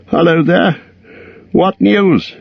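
high, nervous call into the dark; shaky call. A narrator's reading. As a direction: call out, unsure who is there. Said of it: "he called with a shaky voice".

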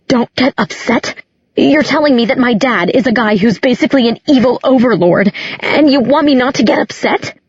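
incredulous. A narrator's reading. Daughter told her dad is evil, incredulous outburst. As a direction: throw the words back in disbelief. Daughter throws his words back.

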